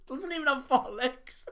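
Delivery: high, loud and slow through laughter; laughing disbelief. Real, not acted. Quizmaster mocking partner's gorilla answer, laughing disbelief. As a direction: squeal it out, helpless with laughter.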